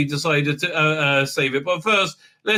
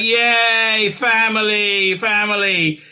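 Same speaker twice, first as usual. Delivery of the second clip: high, drawn-out sarcastic cheer; hammed-up celebration; mock cheering; 4 semitones higher, slower than usual. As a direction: stretch it out with fake glee. Imitating characters cheering their reunion.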